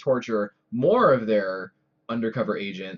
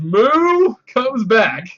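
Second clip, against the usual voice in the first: high, loud, very drawn-out proclamation; slow, stretched, excited shout; hyped announcement; 10 semitones higher, 9 dB louder, much slower than usual. Real, not acted. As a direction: belt out the big comeback. Hyping a character's return to listeners.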